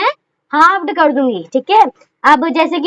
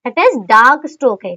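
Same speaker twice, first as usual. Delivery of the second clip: slow answer; 6 semitones lower, much slower than usual. Shown as a demonstration. Teacher stating the answer.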